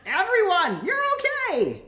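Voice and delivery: high, loud joyful cry; joyful shout. Acted. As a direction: call out to everyone in relief. Calling out joyfully to the group.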